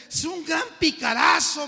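loud, high gossiping jeer; a loud scoffing street kid; badmouthing stranger. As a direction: spit out the insult with relish.

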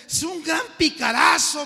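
high, loud denouncing; gossiping young man. Retold or imitated. Young man calls the radio preacher a crook, loud and high. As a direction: spit out the insult. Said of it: "me dijo".

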